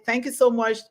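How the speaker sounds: ordinary delivery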